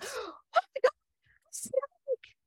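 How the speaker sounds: high breathy terrified gasp; high hushed shriek of fright; shocked gasp. Retold or imitated. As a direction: gasp in horror at the snake. Newcomer gasps at snake.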